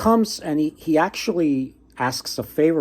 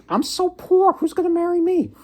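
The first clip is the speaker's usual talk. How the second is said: pitched way up, a plaintive self-pitying poor man; high whiny woodsman; 3 dB louder than usual. Retold or imitated. Poor woodsman lamenting nobody will marry him, high whine. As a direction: whine it up high, sorry for himself. Woodsman voiced in folk tale.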